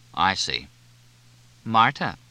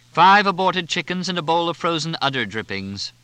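a snooty waiter presenting dishes with relish; grand waiter announcement; 9 semitones higher, 6 dB louder than usual. A narrator's reading. Waiter announcing the lunches to the table, loud and haughty. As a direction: announce it up high and loud like a butler.

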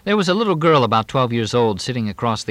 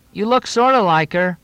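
higher, louder call across the dock; calling after her; 11 semitones higher, 5 dB louder than usual. A narrator's reading. Girl calls after Moxie as she leaves, raised voice. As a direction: call it out to someone walking away. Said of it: "The girl in cutoffs called after Moxie".

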